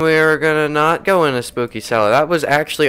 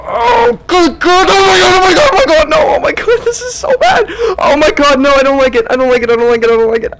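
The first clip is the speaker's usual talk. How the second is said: high panicked shouting; scared horror game streamer; 14 semitones higher, 10 dB louder, faster than usual. Real, not acted. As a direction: scream in pure panic, repeating. Panicking as ghost chases.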